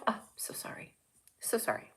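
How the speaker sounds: whispery, soft apology; hushed mock apology. Apologizing to offended viewers, hushed. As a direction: sink into a small, near-whispered sorry.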